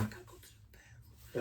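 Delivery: barely audible, voiceless whisper; hushed, sheepish retold confession. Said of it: "I don't know why I'm whispering".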